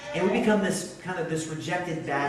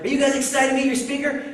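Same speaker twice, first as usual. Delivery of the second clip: much higher, louder rally call to the crowd; hype-up call; 11 semitones higher, 8 dB louder than usual. Retold or imitated. Pastor hyping kids before speaker arrives, excited call. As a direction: pump the kids up.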